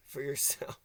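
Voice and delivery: breathy whispered aside. Real, not acted. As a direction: drop to a whisper on the last words. Trailing mocking aside.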